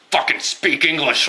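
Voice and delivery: loud, high-pitched imitation of a furious bigot; hammed-up angry demand; mock angry outburst. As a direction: blurt it out in mock rage. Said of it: "why are you so mad".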